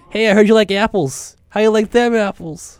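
high, loud hammed-up Boston accent. Co-host quotes a film line, loud Boston accent. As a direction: put on a brash movie Bostonian. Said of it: "get your Boston accent set up".

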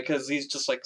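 ordinary delivery

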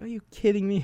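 high-pitched imitated disbelief; mock blow-up. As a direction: squeal it like she just exploded. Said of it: "And she just blew up".

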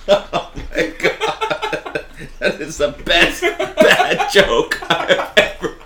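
wheezing through laughter, drawn out and high; laughing hard. Real, not acted. Laughing at a terrible pun from a friend, drawn out and high. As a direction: barely get the words out for laughing.

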